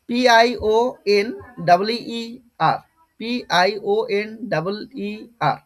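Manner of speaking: slow, letter by letter; measured dictation of single letters; spelling out. Shown as a demonstration. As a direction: spell it out with a gap after each letter. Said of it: "আমি স্পেলিংটা লিখে দিচ্ছি".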